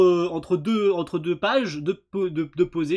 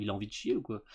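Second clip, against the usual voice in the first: normal talking voice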